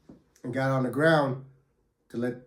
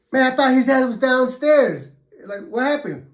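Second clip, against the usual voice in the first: high, louder shocked retold cry; shocked disbelief; 11 semitones higher, 7 dB louder than usual. Narrator shocks at cellmate's news, high loud disbelief. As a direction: blurt it out in disbelief, pitched up. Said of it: "I was like".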